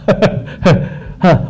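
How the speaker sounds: a breathy chuckle at his own joke; quick amused laugh; short real laugh. Real, not acted. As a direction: laugh it off lightly.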